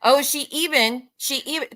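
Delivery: usual speaking voice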